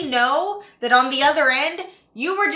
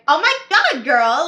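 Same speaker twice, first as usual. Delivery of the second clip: put-on Valley Girl squeal, higher and louder; 3 semitones higher, 8 dB louder than usual. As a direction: gush like a Valley Girl MLM seller. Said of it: "I do the Valley Girl thing".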